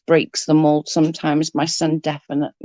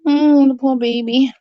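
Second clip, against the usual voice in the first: pitying coo; 7 semitones higher, 4 dB louder than usual. Retold or imitated. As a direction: coo over a poor baby. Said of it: "I was like".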